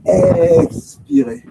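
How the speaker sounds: very drawn-out, high first syllable; slow, high-pitched exhale cue; breathing cue. Real, not acted. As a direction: stretch it high through the whole exhale. Cueing a long exhalation.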